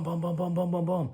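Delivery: high, punchy gun noises; mock gunfire. Storyteller imitating his rifle firing, high and punchy. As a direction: rattle off the shots like a rifle.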